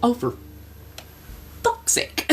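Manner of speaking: whispered, exasperated curse; exasperated aside. Retold or imitated. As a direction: mutter it under your breath in frustration. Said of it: "And I'm like".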